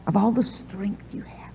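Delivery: secretive whisper in the coaxing plea. Retold or imitated. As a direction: lean in and whisper it.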